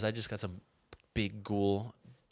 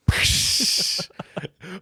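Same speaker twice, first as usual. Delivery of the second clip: laugh; 8 semitones higher, 8 dB louder than usual. Real, not acted. Laugh before impression.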